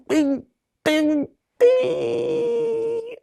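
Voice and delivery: very high squeaky bing bing bing; happy chime sound. Retold or imitated. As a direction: chime out in delight like a bell. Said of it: "And I was like".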